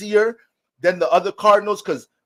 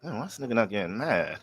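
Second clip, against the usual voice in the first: put-on frustrated girlfriend muttering; 9 semitones lower, 8 dB quieter than usual. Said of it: "You be mad as hell, like".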